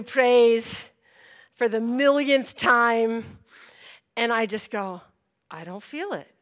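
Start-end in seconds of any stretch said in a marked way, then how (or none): 5.5-6.3 soft, low admission; understated little fib; feigned innocence. Retold or imitated. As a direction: say it small and careful. Retold sly claim of healing.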